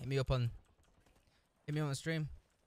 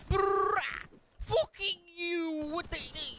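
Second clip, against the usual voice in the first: frustrated wail; 14 semitones higher, 4 dB louder, much slower than usual. Real, not acted. Crying raging outburst.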